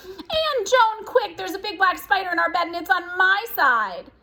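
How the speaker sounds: high, loud squeal of mock panic. A narrator's reading. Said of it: "clutching her bathrobe and squealing".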